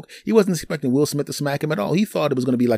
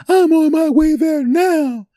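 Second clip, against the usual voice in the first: high, slow, loud mock girlfriend voice; shrill it out like an angry girlfriend; upset girlfriend; 8 dB louder, slower than usual. Girlfriend calling the house, hammed-up upset. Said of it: "she sounded a little upset".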